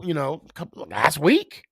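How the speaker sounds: ordinary talk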